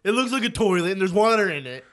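loud, high, defensive protest; mock indignant apology. Man retelling his reply to angry parents, mock indignant. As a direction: play up the wounded outrage.

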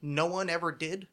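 ordinary talk

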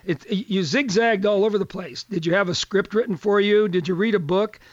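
ordinary delivery

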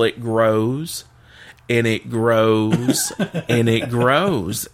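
slow, stretched storytelling build; drawn-out repetition. Retold or imitated. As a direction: stretch each repeat for comic suspense. Suspense build in joke.

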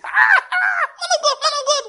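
hammed-up shriek of a churchgoer; mock congregant screaming. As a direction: squeal at the pastor like a frenzied believer. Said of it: "People will be in the, the church service".

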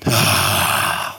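big audible sigh out; releasing exhale. Shown as a demonstration. Host demonstrates the release to listeners, long sighing exhale. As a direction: blow the held breath all out. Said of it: "Blow it all out".

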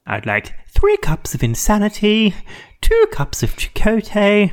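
high, slow, building theatrical order; mock mad-scientist order. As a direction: play it comically grand, stretching the last words. Comic Janeway ordering science.

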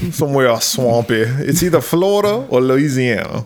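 put-on Southern accent. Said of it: "where they talk like this".

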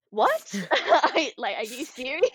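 giggly, incredulous retelling; stunned disbelief. Retold or imitated. Surfer retelling her reply to Dad's sponsorship news, laughing in disbelief. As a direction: react in disbelief, laughter breaking in.

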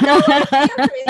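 loud angry yell; screaming. Retold or imitated. Husband screaming back at his wife, loud. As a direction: snap back at full volume. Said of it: "screaming at each other".

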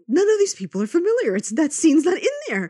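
fed-up cry of frustration; exasperated. Retold or imitated. Frustrated search retold.

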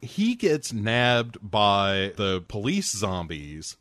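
ordinary delivery

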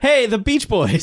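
high slow gushing hello; excited greeting. Retold or imitated. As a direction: greet them like starstruck fans. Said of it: "They're like".

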